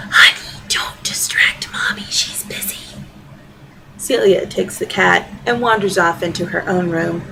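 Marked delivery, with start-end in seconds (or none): 0.1-2.8 hushed voiceless whisper. Acted. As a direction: breathe it as if not to disturb anyone. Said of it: "He whispers to my daughter".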